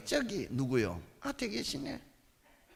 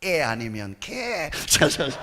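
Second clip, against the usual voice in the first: ordinary talk, nothing marked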